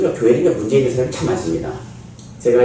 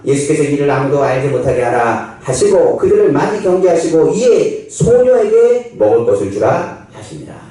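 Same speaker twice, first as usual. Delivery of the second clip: normal talking voice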